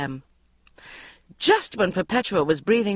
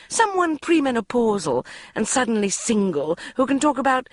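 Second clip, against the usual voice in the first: high wheedling mother; 7 semitones higher than usual. A narrator's reading. Mother persuading Bridget, high and wheedling. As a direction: sweet-talk her into it.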